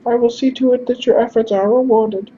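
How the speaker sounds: grateful, formal falsetto; high-pitched character voice. Acted. Messenger thanks stranger and promises reward, high-pitched voice. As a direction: thank them politely, way up high. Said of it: "we're just gonna do high-pitched instead".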